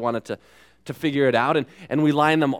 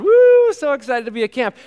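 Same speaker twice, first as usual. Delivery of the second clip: high, loud gushing cheer; camp excitement; 10 semitones higher, 7 dB louder than usual. Retold or imitated. Preacher mimics thrilled campers, high gushing cheer.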